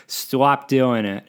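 loud, slow mock Boston accent; exaggerated, amateur Boston heckler; bad Boston accent. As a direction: drag out the vowels in bad Boston. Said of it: "I can't actually do a proper Boston accent".

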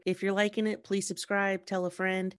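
ordinary talk

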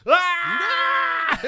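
loud, high-pitched yelp of shock; mock scream. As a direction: let out a sudden shrill yelp from behind the door. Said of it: "we hear him scream".

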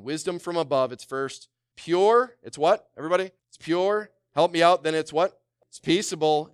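rally the room to answer back; rousing call. Real, not acted.

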